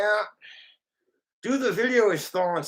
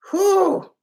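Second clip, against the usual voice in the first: high loud whew; 7 dB louder than usual. Acted. Reacting to a bad fart smell.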